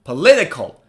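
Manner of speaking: "Political" in one go, LIT stressed; quick "Political" peaking on the second syllable. Shown as a demonstration. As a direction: say "Political" as a whole word, punching LIT. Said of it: "Now say it faster".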